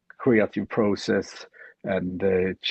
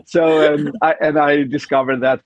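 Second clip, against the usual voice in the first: laughing; 7 semitones higher, 5 dB louder than usual. Real, not acted. Laughing at tattoo remark.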